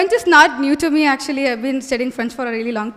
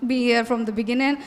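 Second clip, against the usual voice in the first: ordinary talk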